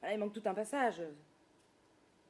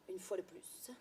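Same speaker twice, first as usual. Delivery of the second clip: whispering; 3 semitones lower, 10 dB quieter than usual. Acted. Muttered self-pity aside.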